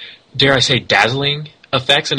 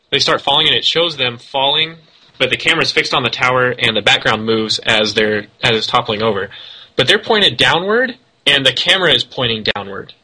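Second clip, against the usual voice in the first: normal talking voice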